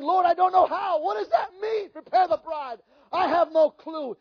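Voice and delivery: high, loud bewildered plea; retold crying out. Preacher retelling his plea to the Lord, crying out. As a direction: cry out, imitating your own helpless confusion. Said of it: "I remember crying out".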